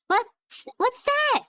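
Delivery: very high-pitched, puzzled squeal; startled question. Real, not acted. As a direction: voice leaps up in surprise. Startled reaction, voice jumps very high.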